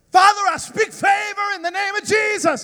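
put-on high, loud child's shout of faith; boy shouting a prayer in the middle of the park. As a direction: throw your hands up and declare it. Child praying aloud in park.

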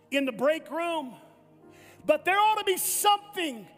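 ordinary talk

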